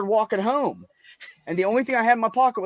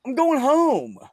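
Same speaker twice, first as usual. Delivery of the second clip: put-on confused drunk; 8 semitones higher than usual. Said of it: "And I'm like".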